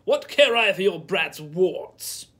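loud, slow, contemptuous; scornful shout. A narrator's reading. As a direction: spit it at the old woman. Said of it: "cried the son".

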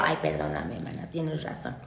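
mock apology. Witch answering the imagined viewer, mock-meek apology. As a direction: say sorry small and sheepish.

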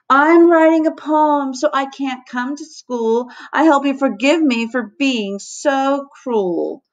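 high, slow sing-song rhyme; recited verse. A narrator's reading. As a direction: recite it like a proud little poem. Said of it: "burst into verse".

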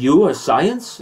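very slow, drawn-out incredulous question; Deepak's mock disbelief. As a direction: stretch it out, teasing disbelief. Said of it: "And then he goes".

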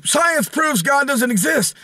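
high, slow proclamation; exaggerated TV pundit pronouncement; mock Bill Maher. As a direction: declare it in a mocking, high-pitched voice. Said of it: "Bill Maher gets on T V and says".

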